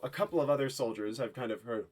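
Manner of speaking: ordinary delivery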